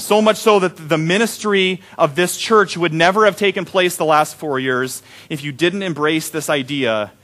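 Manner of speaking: ordinary delivery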